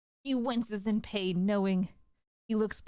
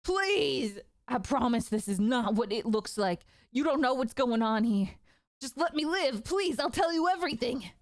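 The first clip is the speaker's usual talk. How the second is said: high, pleading voice near tears; a terrified man pleading, much higher; choking, desperate plea; 4 semitones higher than usual. Acted. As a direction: beg for your life, words catching. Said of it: "his words are choking on what he's saying".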